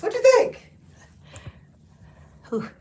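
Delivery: winded from the try-on; breathless after squeezing in; out of breath. Real, not acted. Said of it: "I'm out of breath".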